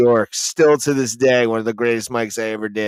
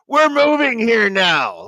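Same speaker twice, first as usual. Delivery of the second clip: put-on boyfriend voice; 10 semitones higher than usual.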